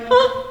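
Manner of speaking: high, brief mimicked whimper; imitated whimper. Ghost hunter showing colleague what was heard, imitated whimper. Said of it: "It sounded like a whimper".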